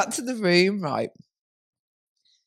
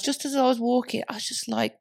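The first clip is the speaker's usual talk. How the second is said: normal talking voice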